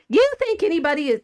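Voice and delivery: high-pitched wealthy lady; hammed-up society lady's scoff. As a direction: lift the voice high, mocking socialite. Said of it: "she would have to be high".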